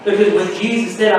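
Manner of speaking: normal talking voice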